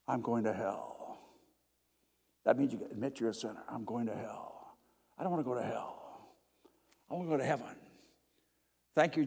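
soft, hushed sinner's prayer; quiet confession to God on your knees; humble prayer. Shown as a demonstration. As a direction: pray it low and broken.

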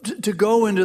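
hint of irritation, send them off; annoyed disciples. Tired disciples wanting the crowd sent away, mildly annoyed. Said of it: "what are they saying?".

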